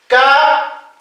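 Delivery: "ka" with a plain unaspirated k; simple consonant. Shown as a demonstration. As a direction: say "ka" without puff or pop. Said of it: "Consonantes simples". (consonante simple, "ka" sin soplo)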